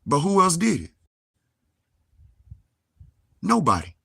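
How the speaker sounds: high and loud, hurling the question out; loud challenge. Real, not acted. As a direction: shout it at the doubters. Challenging the doubters.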